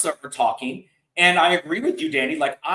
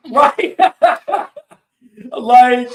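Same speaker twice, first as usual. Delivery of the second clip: high, loud laughing agreement; helpless laughing outburst, pitched high; real laughter; 7 semitones higher, 9 dB louder than usual. Real, not acted. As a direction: crack up while agreeing. Cracking up at co-host's joke.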